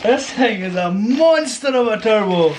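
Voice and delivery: awestruck, stretching the words out; real excitement. Real, not acted. Car fan unboxing a monster turbo, excited. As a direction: marvel at it, voice climbing high at the end. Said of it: "sorry, I'm getting excited here".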